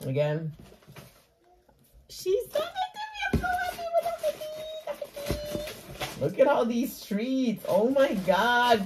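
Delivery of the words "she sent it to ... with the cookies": very high, soft squeal; excited squeal. Real, not acted. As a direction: squeal under your breath, much higher. Squealing over cookies.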